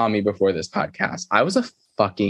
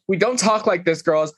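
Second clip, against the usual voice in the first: scolding mom voice; 7 semitones higher than usual. Retold or imitated. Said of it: "Kyle was like".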